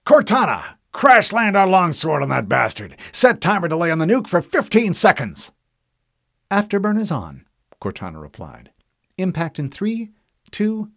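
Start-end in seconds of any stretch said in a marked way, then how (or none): 0.0-5.5 loud, high, forceful orders; urgent, angry battle command; commanding captain. Acted. Captain barks desperate orders. 6.5-7.5 high, alert confirmation; raised, efficient assistant's voice; ship's AI reporting. Acted. Cortana confirms urgent order. 9.2-10.9 high, suspended count; higher-pitched, measured countdown; ship's AI countdown. Acted. Cortana counts down to impact.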